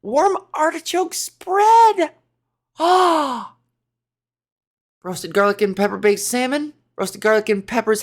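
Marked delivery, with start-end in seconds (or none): 0.0-3.5 high and slow, thrilled; excited. Real, not acted. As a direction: savor each word with delight. Thrilled reading of the recipe list.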